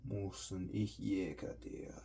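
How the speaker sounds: soft whispered fake German; mock German whisper. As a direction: hiss it like a sneaking soldier. Mock German while sneaking.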